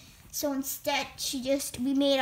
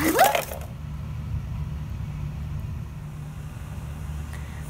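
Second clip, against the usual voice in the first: high, breathy witch cackle; evil cackle; 13 semitones higher than usual. Acted. Witch leaves gloating.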